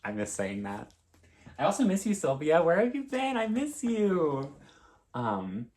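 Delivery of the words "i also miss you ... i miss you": high, loud mock wail of longing; hammed-up high call, last words stretched out; playful wailing. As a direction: cry out theatrically to a missed friend. Calling out to absent friend Sylvia.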